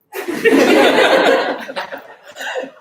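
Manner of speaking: high wheezy laughter; breathless laugh. Real, not acted. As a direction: laugh almost silently. Laughing at her own story.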